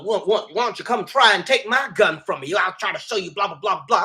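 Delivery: hammed-up Southern twang, trailing into blahs; put-on Southern accent. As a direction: imitate a politician's fake Southern accent. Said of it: "randomly had this magical Southern accent".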